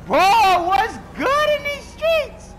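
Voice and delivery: squeaky high, loud, stretched "what's good"; high mock greeting. Mocking an enemy player, silly high greeting.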